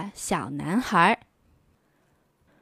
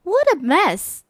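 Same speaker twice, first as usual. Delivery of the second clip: put-on high, dismayed little girl voice; a child groaning at her messy room; 8 semitones higher, 4 dB louder than usual. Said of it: "said Shelley".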